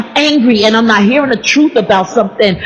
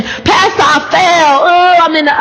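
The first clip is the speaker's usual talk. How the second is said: distressed confession; 6 semitones higher than usual. Retold or imitated. Churchgoer confessing to pastor the morning after, high pleading. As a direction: cry out to the pastor that you fell.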